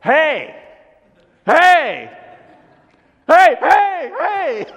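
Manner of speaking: high, loud re-enacted hollering; yelling across the lake. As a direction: call out to a distant boat. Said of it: "baritone voice yelling across the lake".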